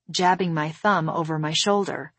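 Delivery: usual speaking voice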